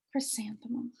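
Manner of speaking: soft unvoiced whisper, like flowers calling; hushed flower whisper calling her name. A narrator's reading. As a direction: breathe the name like a breeze through petals. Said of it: "the flowers seemed to say".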